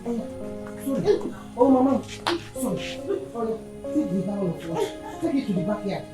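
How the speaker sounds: soft, low comforting murmur; soothing. Acted. Parent comforting a crying daughter, soothing.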